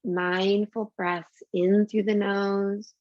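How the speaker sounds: slow, drawn-out breathing cue. Shown as a demonstration. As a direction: stretch each word with the breath. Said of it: "Take three more of those deep".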